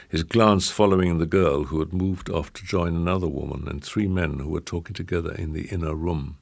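ordinary delivery, nothing marked

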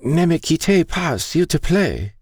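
very high, pleading French; high-pitched plaintive begging; crying out. A narrator's reading. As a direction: plead like a frightened old woman. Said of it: "she cried".

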